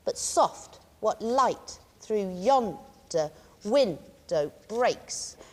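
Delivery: very slow, pausing after each strong beat; spaced-out line marking "yon" and "win" as strong; weak-strong beats. Shown as a demonstration. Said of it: "for a strong rhythm".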